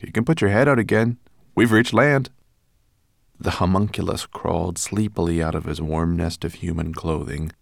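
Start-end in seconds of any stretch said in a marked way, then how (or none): 0.0-2.3 higher, louder and quick; eager announcement. A narrator's reading. Ben tells Twigleg they have reached land, excited. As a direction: tell the good news eagerly.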